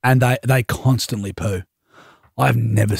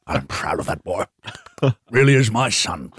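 put-on proud old father; 7 semitones lower than usual. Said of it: "lit my pipe, sat there".

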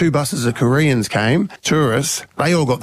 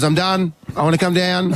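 higher voice, lightly mimicking a child; pleading little girl; 6 semitones higher than usual. Daughter asks dad to come down, childlike plea. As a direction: imitate a kid who has had enough.

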